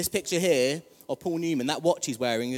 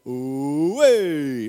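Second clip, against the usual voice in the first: loud hooting ooh; 11 dB louder than usual. Shown as a demonstration. Preacher demonstrating the crowd's cheer, loud ooh. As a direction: sing out a big ooh. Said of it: "I wanna go".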